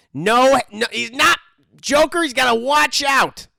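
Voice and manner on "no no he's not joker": normal talking voice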